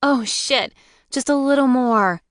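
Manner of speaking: high pleasured moan; moaning with pleasure. Acted. Moaning during massage.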